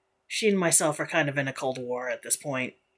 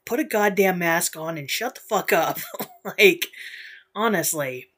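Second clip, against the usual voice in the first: ordinary delivery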